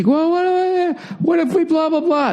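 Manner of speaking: high mock whiny girlfriend voice; imitating girlfriend pleading. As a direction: squeak out her protest like a hammed-up whining partner. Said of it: "And then she".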